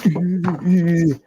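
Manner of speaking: high mock giggle; imitated laugh. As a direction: mimic the friend's laughing, high pitched. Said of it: "He laughing".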